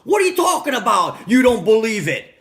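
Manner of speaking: loud, high mock-dad tirade; imitating angry dad. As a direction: shout like an outraged dad, acted out. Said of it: "this is how he talks".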